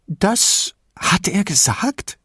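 put-on high, slow, eager old lady; eager old woman. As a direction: perk up with sudden interest. Old woman suddenly perked up.

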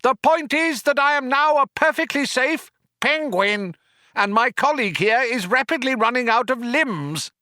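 high indignant rant; raging. A narrator's reading. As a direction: rage on at a high pitch. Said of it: "raged Ford".

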